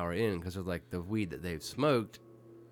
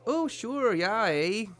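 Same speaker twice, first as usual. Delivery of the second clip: high, loud, hammed-up American accent; 13 semitones higher, 7 dB louder, much slower than usual. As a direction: put on an exaggerated American voice. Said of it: "Can you do your best American accent?".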